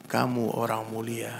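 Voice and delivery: slow, low and soft; hushed, tender imitation of a kind preacher; very sweet, tender. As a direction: speak it gently, as a kind blessing. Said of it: "lisan yang sangat manis".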